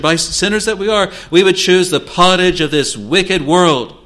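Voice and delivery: sermon climax crying out against sin; climactic preaching. Acted. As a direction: climb the pulpit and thunder it.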